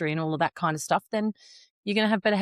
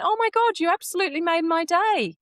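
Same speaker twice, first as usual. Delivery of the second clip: much higher, louder gushing thrill; delighted; 11 semitones higher, 4 dB louder than usual. Retold or imitated. A woman at breakfast gets a compliment, squealing delight. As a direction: gush like a stranger whose day was made.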